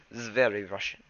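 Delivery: mock Russian accent. Shown as a demonstration. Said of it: "Shadow Clan".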